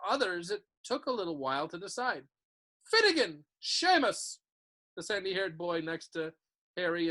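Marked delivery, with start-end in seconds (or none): 2.8-4.4 calling out names. A narrator's reading.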